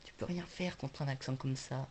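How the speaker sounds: hushed, unvoiced whisper; whispered aside. Vlogger boasting of his unbeatable accent, whispered aside. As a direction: whisper it very softly, like a secret boast.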